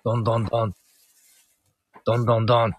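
spoken knock knock knock on a door; lightly voiced door knocking; mock knocking. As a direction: tap out the knocks with the voice.

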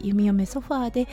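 normal talking voice

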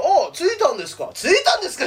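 mocking imitation. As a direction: mimic a cheeky junior's casual reply. Mocking younger comic's greeting.

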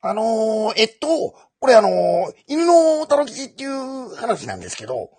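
high, loud Kansai storyteller voice; Junichi Kawamoto impression. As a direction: tell it like the comedian himself. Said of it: "河本純一さんの、滑らない話".

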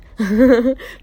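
laugh. Real, not acted. Amused burst after a remark.

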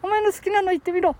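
high-pitched puppet challenge; small, bossy high voice; cheeky puppet sidekick. Pretend. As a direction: prod him as the little dummy, pitched high.

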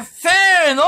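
calling out. Real, not acted. Cueing the group shout.

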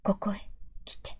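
soft, breathy whisper fading out; like a distant voice whispering a summons; hushed calling voice. Acted. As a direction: beckon in a faint whisper. Mysterious voice calling him.

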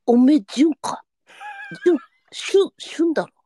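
tearful, pleading old-actor impression; Tanaka Kunie impression. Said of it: "田中邦衛さん、こんばんは。".

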